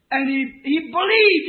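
loud, rising preacher's cry; fiery sermon shout; preaching shout. Real, not acted. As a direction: build the fervor up loud.

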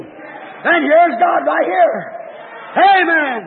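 high, ringing calls in short phrases; exultant proclamation with long pauses; fervent preaching. Real, not acted. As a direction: revival preacher pressing the congregation. Preaches at full force.

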